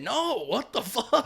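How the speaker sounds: slow, high and loud with a laugh; half-laughing refusal; laughing protest. Retold or imitated. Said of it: "I said".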